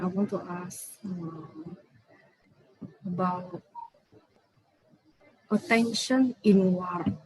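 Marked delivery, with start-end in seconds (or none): 0.0-3.6 soft, hesitant question with long gaps; too quiet. Real, not acted. Student asking the teacher a question, too quietly. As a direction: ask it softly, feeling for the words. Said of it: "Can you speak a little bit louder?".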